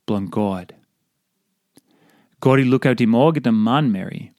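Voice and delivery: normal talking voice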